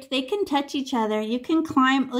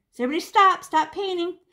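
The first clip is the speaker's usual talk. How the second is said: raised commanding call; 7 semitones higher, faster than usual. Real, not acted. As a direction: call out to halt the class. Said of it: "teacher halting the class".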